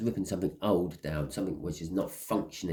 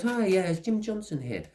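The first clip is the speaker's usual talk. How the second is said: high, cheery stranger asking for Jim Johnson; stranger at the door; 10 semitones higher than usual. Retold or imitated. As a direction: pitch it up like a polite stranger at the door. Stranger knocking, retold again.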